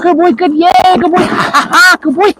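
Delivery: high-pitched praise with playful laughs; giddy high play voice; excited dog praise. Real, not acted.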